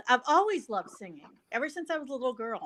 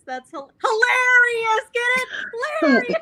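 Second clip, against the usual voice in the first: high, loud, laughing and crying; laughing shout; 12 semitones higher, 6 dB louder than usual. Real, not acted. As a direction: shout it through tears of laughter. Laughing riff on a word.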